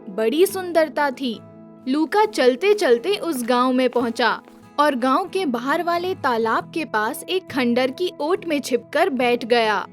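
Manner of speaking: ordinary delivery, nothing marked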